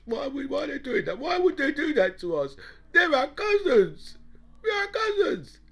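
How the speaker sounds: high, whiny mock protest climbing to a wail. The English wondering why their cousins would do that, mock wailing. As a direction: plead in a hammed-up cry of disbelief. Mimics the English whining to themselves.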